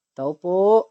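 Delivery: loud call to the house. Real, not acted. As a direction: call out to whoever's inside. Calling out at the door.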